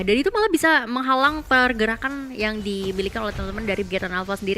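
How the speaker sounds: normal talking voice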